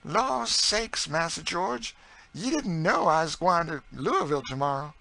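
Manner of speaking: high, loud dialect voice bursting with news; excited Southern cook. A narrator's reading. Narrator voices Chloe telling George.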